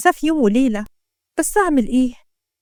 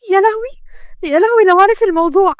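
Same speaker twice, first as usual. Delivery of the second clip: panicked; 7 semitones higher than usual. A narrator's reading. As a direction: dread being found out, pitch leaping up. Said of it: "شمس لما سمعت صوت عمها اتنفضت". (بخضة ورعب)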